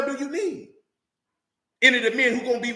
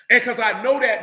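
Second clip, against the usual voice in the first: normal talking voice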